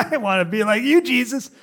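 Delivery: high, laughing, eager; eager laughing Peter. Pretend. Peter to Jesus, eager and laughing, high and loud. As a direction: bubble over with excitement.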